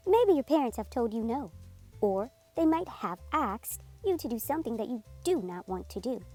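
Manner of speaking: usual speaking voice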